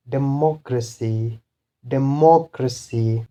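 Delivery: democracy with MOC stressed; stress on third-last syllable. Shown as a demonstration. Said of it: "third last is this one".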